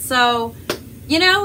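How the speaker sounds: usual speaking voice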